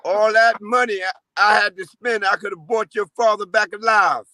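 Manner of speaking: ordinary talk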